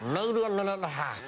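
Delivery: squeaky high helium voice, laughing. Retold or imitated. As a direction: still stuck on helium, sounding worried. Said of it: "one voice where it sounded like I was in a terrible helium accident".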